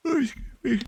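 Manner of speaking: yawning through the words. Real, not acted. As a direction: talk mid-yawn. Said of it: "I'm yawning like crazy".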